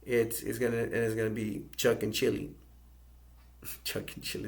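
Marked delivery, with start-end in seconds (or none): 3.6-4.5 amused, laughing over the names. Real, not acted. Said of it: "that sounds funny".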